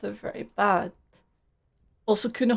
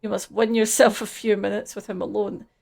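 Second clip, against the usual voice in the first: usual speaking voice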